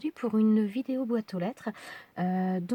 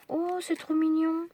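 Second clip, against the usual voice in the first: higher, squealing with delight; 7 semitones higher than usual. Real, not acted. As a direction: coo over something cute. Discovering a cute card. (ravie, voix aiguë attendrie)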